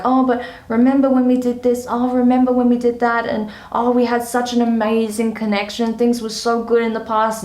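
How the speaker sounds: hammed-up ex gushing about the good old days; mock-nostalgic ex. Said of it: "this person be like".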